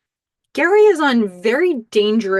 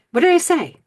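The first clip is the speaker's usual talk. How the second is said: higher, louder baffled call; acted protest; 10 semitones higher, 5 dB louder than usual. Man following his date as she storms out, acted protest. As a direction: call after her in mock bewilderment. Gary calling after Daisy storming out.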